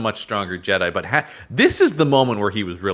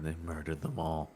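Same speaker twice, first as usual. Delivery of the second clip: crying; 5 semitones lower, 17 dB quieter than usual. Retold or imitated. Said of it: "Luke is crying, going".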